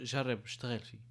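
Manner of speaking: ordinary talk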